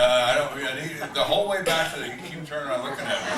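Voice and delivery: normal talking voice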